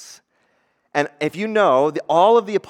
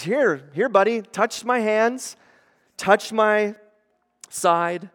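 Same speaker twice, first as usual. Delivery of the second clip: tender invitation; 6 semitones higher, slower than usual. Retold or imitated. Jesus voiced inviting Thomas.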